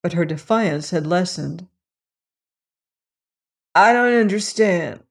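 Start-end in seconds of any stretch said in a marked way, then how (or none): 3.8-5.0 harsh. Acted. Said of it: "her voice was harsh".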